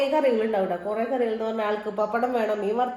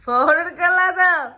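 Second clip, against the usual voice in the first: raised voice, asking loudly; calling out loud; 4 semitones higher, 6 dB louder than usual. Real, not acted. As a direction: shout it across the yard. Shouting across to family.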